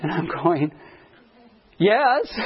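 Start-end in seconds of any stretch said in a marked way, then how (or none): 1.8-2.4 eager yes. Retold or imitated.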